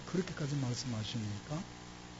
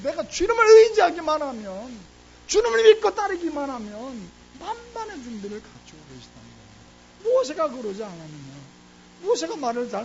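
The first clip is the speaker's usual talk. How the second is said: rising, ringing preacher's build, much louder; a pastor's fervent sermon climax; full-force preaching; 11 semitones higher, 11 dB louder than usual. Real, not acted. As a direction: drive it home to the congregation like a revival preacher.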